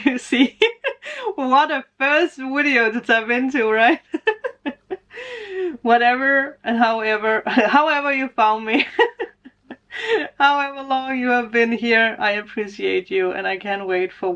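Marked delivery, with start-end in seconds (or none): 4.1-5.8 high delighted laugh; high bright laugh. Real, not acted. Vlogger joking about viewers' first video, high laugh. 9.7-10.3 high laugh. Real, not acted. Laughing mid-thanks.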